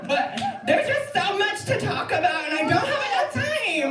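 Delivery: high-pitched, exasperated wail of overwhelm; overwrought protest. Acted. Performer overwhelmed by all there is to say, frantic.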